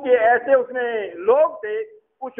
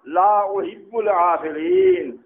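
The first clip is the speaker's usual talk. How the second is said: Quranic recitation; 2 semitones lower, much slower than usual. Acted. Quranic quote recited slowly.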